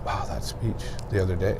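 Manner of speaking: low, soft imitation of awe; softly marvelling, as retold; hushed awe. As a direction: quote an impressed listener under your breath. Voices impressed people's reaction.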